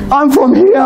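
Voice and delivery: normal talking voice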